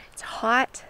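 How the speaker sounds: breathless from the heat; puffing, catching her breath. Real, not acted. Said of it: "Bear with me as I'm catching my breath".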